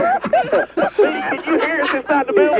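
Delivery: high, slow, laughing question. Real, not acted. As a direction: ask through helpless laughter. Laughing after bullhorn prank.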